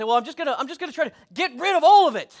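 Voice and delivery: high, loud, rushed exasperation; desperate resolve. Acted. Acted-out desperate resolve against temptation.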